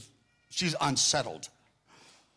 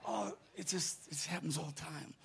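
offhand, soft; 12 dB quieter than usual. Retold or imitated. Prophet shrugs off gift.

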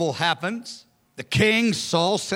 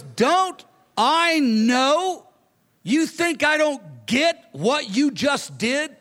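angry king; 6 semitones higher, much slower than usual. Retold or imitated. Saul confronting Jonathan.

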